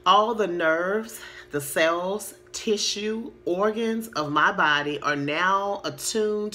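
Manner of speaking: slow, deliberate read-aloud with drawn-out words; slow affirmation reading. Shown as a demonstration. Coach reading a health affirmation for viewers to write down, slowly. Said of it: "try to say it as slow as possible".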